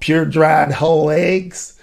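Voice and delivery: slow, lightly put-on ad voice; a hint of Dan the egg salesman. Guest echoes the egg ad as Dan. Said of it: "I can come back as Dan".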